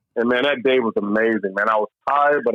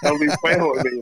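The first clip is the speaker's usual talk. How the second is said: mom's disbelief; 6 semitones higher than usual. Retold or imitated. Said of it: "she's like".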